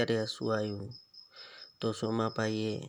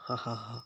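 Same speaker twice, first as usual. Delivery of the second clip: crazy laugh; 4 dB quieter than usual. Shown as a demonstration. Teacher shows pupils how to laugh crazily. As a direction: demo of a madman's cackle. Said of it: "ગાંડાની જેમ હસો".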